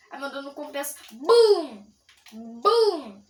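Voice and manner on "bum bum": loud mouth gunshot boom; shouted boom. Pretend. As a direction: yell a gunshot noise. Gunshot sound effects.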